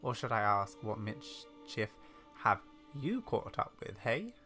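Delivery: brisk hushing, then measured teasing; old lady character. Acted. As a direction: brush off questions like a knowing granny.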